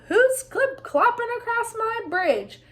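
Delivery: troll demands who is crossing his bridge; troll roar. A narrator's reading. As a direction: call up from under the bridge. Troll roars from below.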